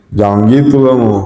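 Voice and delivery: ordinary talk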